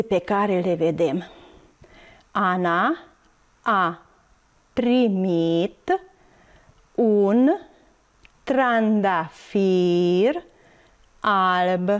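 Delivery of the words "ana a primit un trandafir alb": slow, word by word with long pauses; very slow model reading for children learning to read; beginner's reading demonstration. Shown as a demonstration. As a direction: read syllable by syllable, drawing out each vowel. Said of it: "Haideți să citim".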